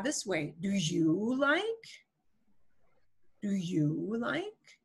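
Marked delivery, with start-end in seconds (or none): 0.6-1.9 "you" stretched slowly, rising into "like"; stress on YOU. Shown as a demonstration. Teacher demonstrating emphasis in English, "you" stressed. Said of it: "inflection of our voice on that word you". 3.4-4.8 slow, softer question with a long "you"; stress on YOU. Shown as a demonstration. Teacher repeating the English example, "you" stressed. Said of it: "inflection of our voice on that word you".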